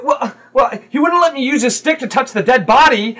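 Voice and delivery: put-on high, loud child voice; protesting kid. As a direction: argue back loudly like an indignant child. Kid argues after slap.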